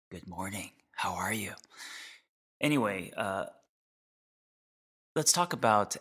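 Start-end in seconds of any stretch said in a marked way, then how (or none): 0.1-1.5 creepy ear whisper. Shown as a demonstration. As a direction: close breathy whisper in the ear. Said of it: "creepy in your ear".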